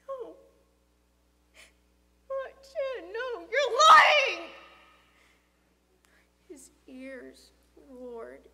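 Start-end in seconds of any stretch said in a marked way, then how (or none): 0.1-4.5 high, building to a drawn-out shout; horrified denial. Acted. Boy denying friend's death, horrified. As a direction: refuse it, rising to a shouted accusation.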